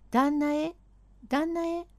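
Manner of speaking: high call into the dark room. Acted. Servant calls into the dark bedroom, high call.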